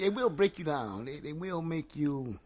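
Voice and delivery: ordinary talk, nothing marked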